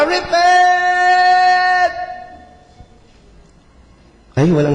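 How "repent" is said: high, loud drawn-out cry of repent; mock desert shout. Preacher mimicking John crying out in the empty desert, loud high call. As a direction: shout it like a prophet alone in the desert. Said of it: "sumigaw ka".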